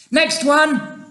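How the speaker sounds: high, loud call; shouting. A narrator's reading. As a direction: call briskly for the next. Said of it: "he shouted".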